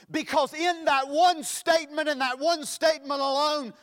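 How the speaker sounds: loud, high, insistent pulpit delivery; full-force preaching. Real, not acted. Preacher expounds one powerful statement, preaching at full force. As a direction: drive each phrase home to the congregation.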